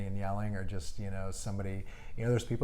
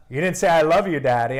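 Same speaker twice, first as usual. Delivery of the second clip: put-on higher, louder whiny child protest; a hint of a pouting little girl; imitating his daughters; 5 semitones higher, 11 dB louder than usual. Daughter scolding dad at bedtime, in a higher, louder, lightly whiny child voice. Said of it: "they're like".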